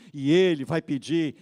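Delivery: normal talking voice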